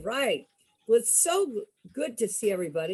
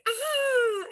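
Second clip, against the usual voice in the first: mock scared cry. Mock scare after spooky song.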